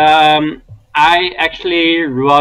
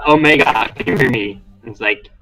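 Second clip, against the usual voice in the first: mechanical voice; 7 semitones lower than usual. Acted. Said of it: "a weird mechanical voice".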